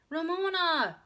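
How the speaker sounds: high, loud reproachful call; crying out. A narrator's reading. Big sister stops noisy child, crying out. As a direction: call out in protest. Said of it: "cried Beezus".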